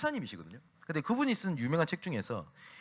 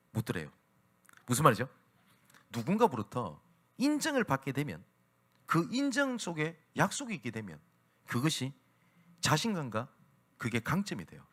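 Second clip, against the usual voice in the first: ordinary talk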